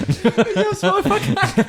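high squeaky laughing words. Real, not acted. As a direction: laugh through the line. Laughing at own ramble.

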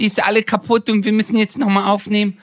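high whiny voice with a slushy lisp. Retold or imitated. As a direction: imitate a lisping crybaby partner. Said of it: "wie du mich als heulende, lispelnde Lusche nachmachst".

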